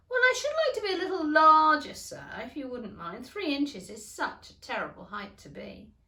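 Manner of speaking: high, loud, fast whimpering plea; a girl close to crying; tearful Alice. A narrator's reading. Alice pleads, near tears.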